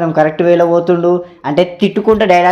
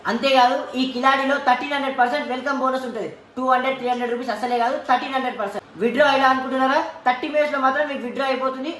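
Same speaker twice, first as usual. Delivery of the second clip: higher and much softer promo read; sponsor ad read; 7 semitones higher, 10 dB quieter than usual. Acted. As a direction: sell the betting app like a radio spot. Reads a sponsor advert.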